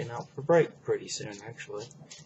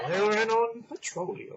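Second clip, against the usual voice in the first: put-on very old man; 11 semitones higher, 6 dB louder than usual. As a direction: drag it out like a great-grandpa. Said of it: "you have to say that in an old man voice".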